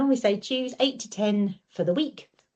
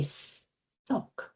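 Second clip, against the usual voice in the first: whispered sock; 10 dB quieter than usual. Shown as a demonstration. Modelled answer.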